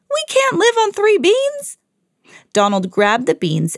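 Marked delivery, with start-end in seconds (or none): 0.1-1.8 squeaky high disbelieving cry of a cartoon farmer. Farmers to Mickey, a shrill indignant cry. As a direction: shriek it in comic disbelief. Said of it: "they cried".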